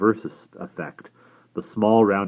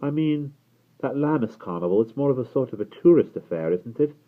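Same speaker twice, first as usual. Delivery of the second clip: higher, hedging explanation; apologetic; 8 semitones higher, 4 dB louder than usual. A narrator's reading. Will explaining the tourist affair to Barney, apologetically. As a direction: explain it tentatively, as if sorry to disagree. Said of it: "sounding apologetic".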